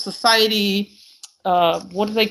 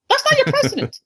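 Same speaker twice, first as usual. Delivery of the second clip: much higher, insistent second "That's not your president"; emphatic repeat; 14 semitones higher than usual. Retold or imitated. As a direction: say it again, pitched way up to drive it home. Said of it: "I'm like".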